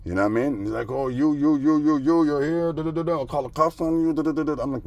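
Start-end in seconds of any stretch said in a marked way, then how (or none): 0.9-4.6 higher, stammering parody of a flustered man; mock sputtering neighbor. Mocking the neighbor threatening to call the cops, high sputter. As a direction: sputter like a man too riled to finish.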